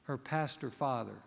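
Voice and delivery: ordinary talk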